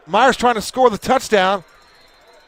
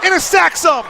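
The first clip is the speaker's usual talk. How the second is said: excited play-by-play; 6 semitones higher than usual. Real, not acted. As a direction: call the sack at full excitement. Big defensive play called live.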